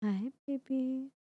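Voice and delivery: high, slow cooing at a purring cat. Real, not acted. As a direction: pet voice, high and drawn out.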